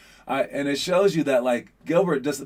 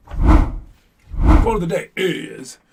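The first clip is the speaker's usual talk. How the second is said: louder, slower, lifted pitch, a big reveal; drawn-out announcement; 4 semitones higher, 8 dB louder, much slower than usual. Acted. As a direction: stretch it out slow and loud like an announcer. Announcing the daily quote.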